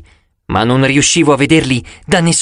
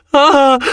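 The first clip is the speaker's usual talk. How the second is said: short, high narrated squeal; a little yelp, much higher than usual; boy's shriek; 12 semitones higher, 3 dB louder than usual. A narrator's reading. Young boy scared by a skull face, high shriek. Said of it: "strillò il ragazzino".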